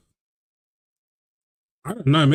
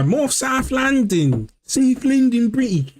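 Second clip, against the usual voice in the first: South London accent; 10 semitones higher, slower than usual. Shown as a demonstration. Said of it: "more South London British".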